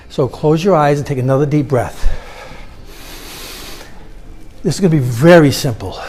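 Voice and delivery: quiet guide speaking over background music; guided meditation. Acted. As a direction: ease the room into stillness. Leads a meditation.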